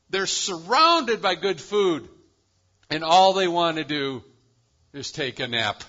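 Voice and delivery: swelling, loud pulpit delivery; full-force preaching. Acted. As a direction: preach it out big and ringing. Preacher swells over content sheep.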